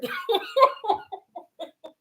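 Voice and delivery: high, trailing laughing fit; breathless giggles, high-pitched; helpless laughter. Real, not acted. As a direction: laugh until you're suffering. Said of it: "I am laughing so hard".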